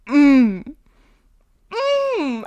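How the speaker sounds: loud, high hums of pleasure; corn-eating moans. Retold or imitated. Bob eating corn at table, loud high moans. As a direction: moan over the corn.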